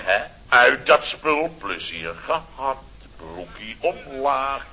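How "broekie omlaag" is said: ordinary delivery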